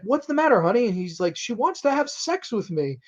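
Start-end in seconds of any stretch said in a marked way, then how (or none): 0.0-0.9 high-pitched mock motherly voice; put-on high wifely concern. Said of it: "The mother comes into the room and she's like". 1.4-3.0 high-pitched mock complaint, softening; put-on high, bewildered voice; distressed dad. Retold upset dad telling mother.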